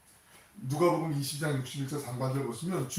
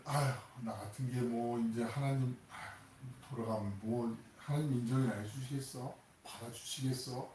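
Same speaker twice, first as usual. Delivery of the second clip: softer, sighing self-doubt; despairing sigh; 7 dB quieter than usual. Retold or imitated. A sinner doubting God's forgiveness, sighing despair. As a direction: mutter it like someone who gave up.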